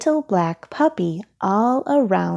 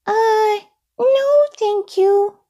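very high puppet voice, slowing on a polite refusal; high, hesitant little character; Elmo, polite; 4 dB louder, slower than usual. A narrator's reading. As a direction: decline politely in a tiny high voice. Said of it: "replied Elmo politely".

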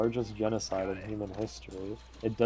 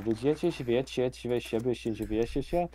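Polish accent mimic; faster than usual. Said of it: "unironically tell a Polish person".

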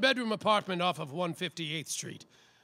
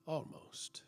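hushed whisper; 3 semitones lower, 12 dB quieter than usual. A narrator's reading. Detective narrator closing the night, whispered. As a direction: breathe the last word, voiceless.

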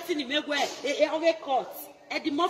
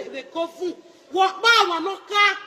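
normal talking voice

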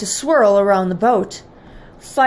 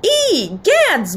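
high-pitched storybook shock; startled exclamation; 9 semitones higher than usual. A narrator's reading. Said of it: "Mother exclaimed".

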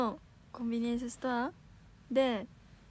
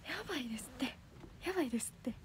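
whisper; 6 dB quieter than usual. Real, not acted. Streamer whispers to chat, sounding like an auntie, in a hushed whisper. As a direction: hush it down to a secret whisper. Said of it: "やば、おばさんみたいになっちゃった".

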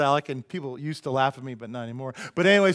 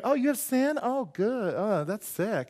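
high, falling mock revulsion; mock disgust; 7 semitones higher, slower than usual. Woman hearing of sin in church, mock disgust. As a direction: imitate a scandalized woman recoiling. Voices her disgust at sinners.

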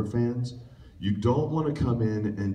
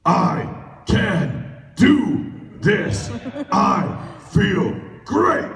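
much higher, louder and very slow, cheesy triumph; escalating loud hammed-up yes yes yes; over-the-top line reading; 13 semitones higher, 6 dB louder, much slower than usual. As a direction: ham it up like a bad cartoon hero. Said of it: "And the line that was written".

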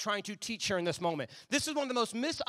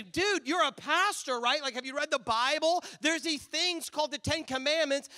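higher, slow, incredulous scolding; mock scolding congregant; 7 semitones higher, 4 dB louder than usual. Congregant scolding the pastor who took the TV, incredulous. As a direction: talk down to the pastor like he should know better.

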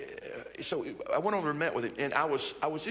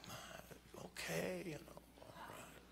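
barely voiced, much softer; whispered bewilderment; 6 semitones higher, 16 dB quieter, much slower than usual. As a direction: whisper it, at a loss for words. Inner reply, lost for words.

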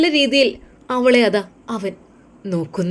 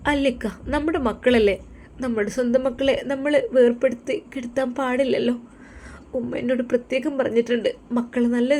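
higher wife voice, gently pleading; pleading young wife; 5 semitones higher than usual. Acted. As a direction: coax him to bring the kids. Wife insists on children.

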